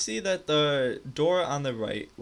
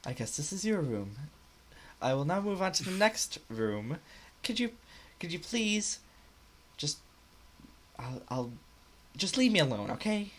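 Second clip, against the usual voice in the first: halting, strained politeness in a higher pitch; forced niceness; 5 semitones higher, 4 dB quieter than usual. Acted. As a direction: plead haltingly while forcing yourself to stay polite. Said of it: "she has to force being ni-, nice".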